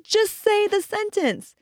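high, slow, spaced-out demand; retold exasperated plea. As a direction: plead with a stubborn child, pitch up. Exasperated mother demands answer.

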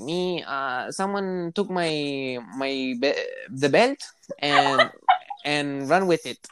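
imitating his kid self in halting broken English. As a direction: stumble through it like a kid with little English. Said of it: "my little Mexican ass that did not know English".

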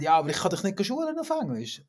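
normal talking voice

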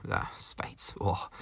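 breathy longing whisper; whispered yearning for a beer; wistful whisper. Real, not acted. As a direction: sigh the name like a craving.

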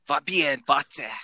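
slow foreign pronunciation of "Fabien Batze", German-style; German accent. Shown as a demonstration. Host reads the mystery player's name for co-hosts, German accent. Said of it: "Saksalaisella aksentilla".

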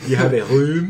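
hammed-up bumbling French accent, loud; mock the mangled French vowels; Clouseau-style French accent. Co-host teasing the bad accent, loud mock French. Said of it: "Inspector Clouseau audiobooks".

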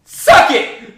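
high, loud taunt at someone leaving; taunting shout. Real, not acted.

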